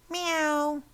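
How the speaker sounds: high mock meow; a playful cat's meow, pitched high. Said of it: "the cat would say".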